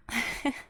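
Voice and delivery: brief rising giggle; a suppressed little laugh; light giggle. Acted. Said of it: "Are you laughing?".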